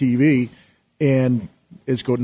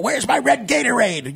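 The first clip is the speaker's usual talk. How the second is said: high, slow, whiny-demanding imitation; mock diva yell; 11 semitones higher than usual. Mimicking Scott demanding his drink from everyone, mock diva yell. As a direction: imitate a star yelling for service, pitched way up. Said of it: "yelling to everyone".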